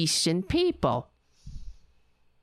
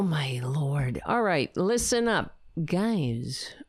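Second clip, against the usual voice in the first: ordinary talk, nothing marked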